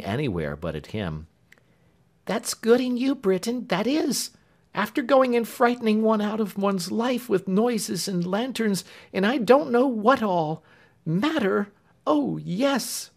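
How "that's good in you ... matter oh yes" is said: put-on high, loud, huffy young maid; indignant Clemency. A narrator's reading. Clemency scolding Britain for frightening her, high and indignant. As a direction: scold him in a high flustered voice.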